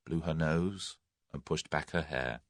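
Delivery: ordinary delivery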